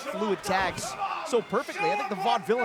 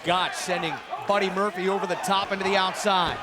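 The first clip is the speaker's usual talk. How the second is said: higher, excited play-by-play; wrestling commentator; 5 semitones higher than usual. Acted. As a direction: build the call toward the pin. Live play-by-play call.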